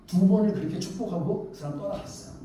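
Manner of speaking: usual speaking voice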